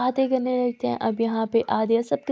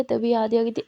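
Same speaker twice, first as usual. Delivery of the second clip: usual speaking voice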